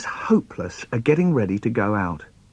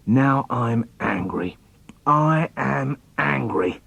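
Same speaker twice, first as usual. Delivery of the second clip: slow, deliberate, contained anger; measured mock fury, very slow; acted simmering anger; slower than usual. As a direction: spell out the anger word by word, slowly. Acted child declares anger.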